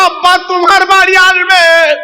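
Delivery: put-on child's excited shout, preacher-style; excited announcement. Excited children announce guest to mother.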